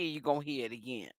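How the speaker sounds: low, quick muttered repeat; quiet aside. Shown as a demonstration. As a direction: drop it to a quick murmur. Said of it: "I want y'all to listen to this".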